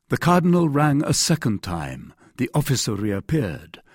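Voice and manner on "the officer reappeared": ordinary delivery, nothing marked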